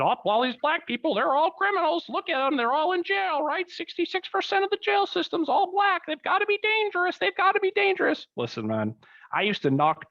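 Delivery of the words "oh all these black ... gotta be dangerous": much higher, sneering parody of a fearmongering newscaster; mock news voice. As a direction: ham up a scaremongering TV report. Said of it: "the news has said".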